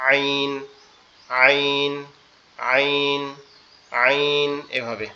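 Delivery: আইন pressed from the throat, slowly drawn out; throaty ain. Shown as a demonstration. Teacher demonstrates the throaty আইন to students. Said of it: "গলাটা একটু চেপে হবে".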